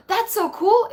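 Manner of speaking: sudden high, slow gasp of delight; thrilled by the comment, voice jumps up. Real, not acted.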